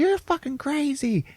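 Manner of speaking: very high, drawn-out protest; mock outraged cry. Hooker running away from him, mock outraged. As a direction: imitate a woman crying out as she flees.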